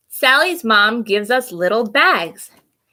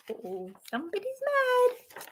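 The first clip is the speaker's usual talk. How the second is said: high, much softer teasing aside; hushed aside; 11 semitones higher, 12 dB quieter than usual. Real, not acted. Reader comments to kids.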